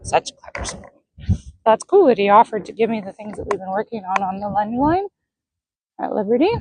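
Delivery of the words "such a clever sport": whispered little horse voice, light and pleased; horse's thoughts. Retold or imitated. Owner voicing her horse's proud nicker, whispered. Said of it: "So you hear that little nicker?".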